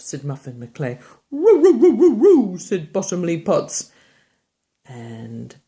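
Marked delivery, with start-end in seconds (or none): 1.3-2.6 high loud laughing howls; howling dog. Acted. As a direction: howl like a playful dog.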